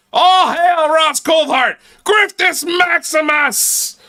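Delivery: mock hail. Mocking the guest as an emperor with a slow, loud mock hail. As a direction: chant it like hailing an emperor.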